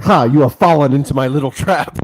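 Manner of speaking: gloating cartoon villain voice. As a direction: sneer it like a scheming villain. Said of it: "he's like".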